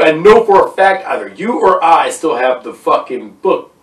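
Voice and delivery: ordinary talk, nothing marked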